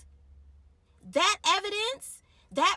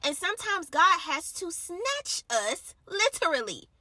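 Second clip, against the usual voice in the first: preaching; 4 semitones higher, 8 dB louder than usual. Real, not acted. Preaching at full force.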